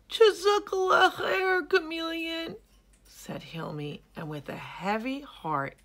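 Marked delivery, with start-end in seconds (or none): 0.1-2.6 high, loud, crying hippo voice; tearful young hippo. Acted. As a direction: thank through tears. Hilmi thanks, heavy heart.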